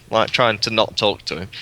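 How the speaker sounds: normal talking voice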